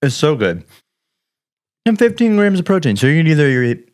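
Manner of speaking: chewing while talking; mouth full. Real, not acted. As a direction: talk through a bite of food. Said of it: "got my mouth full now".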